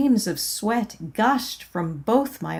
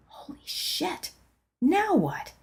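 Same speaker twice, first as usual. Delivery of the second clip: shocked whisper; 8 semitones higher, 5 dB quieter than usual. Acted. Startled by prayer stuck looping.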